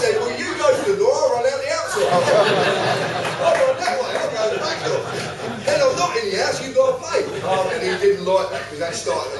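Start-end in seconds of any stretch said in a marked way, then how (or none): 0.2-7.2 loud, pitched-up playful defiance; retold joking taunt. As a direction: tease the copper, loud and high. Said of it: "I was making a joke out of it".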